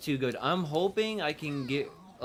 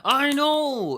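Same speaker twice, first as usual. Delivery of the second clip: high, loud excited cry; excited shout; 13 semitones higher, 11 dB louder than usual. Real, not acted. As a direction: burst out in delight. Excited outburst about rerun.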